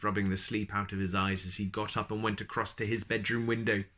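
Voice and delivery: ordinary delivery, nothing marked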